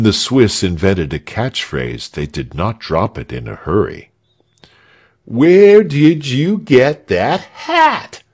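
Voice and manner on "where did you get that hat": slow, loud, high jeering chant; shouted. A narrator's reading. The rabble shouting at the guards, slow loud taunt. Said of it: "they shouted".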